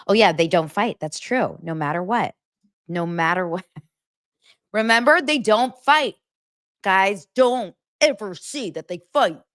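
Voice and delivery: ordinary delivery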